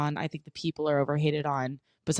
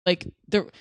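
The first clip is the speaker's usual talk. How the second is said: normal talking voice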